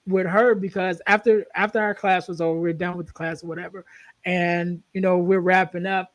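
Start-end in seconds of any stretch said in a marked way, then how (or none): none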